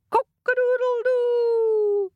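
long, high, loud rooster crow; high sustained cock-a-doodle cry. A narrator's reading. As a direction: crow out loud and drawn-out to wake the sleeper. Rooster crows to wake king.

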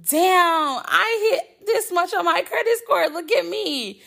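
high, laughing brag to herself; giddy self-quote. Retold or imitated. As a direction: gush with glee.

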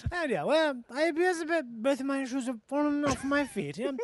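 put-on drunken posh British accent. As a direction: slur it like a tipsy English squire. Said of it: "British guy that announces to a room".

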